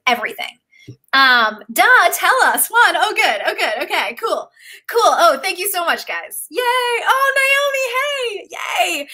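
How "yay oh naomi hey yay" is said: excited greeting. Real, not acted. Host greeting a newcomer in the chat, excited shout. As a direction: shout hello to a friend arriving.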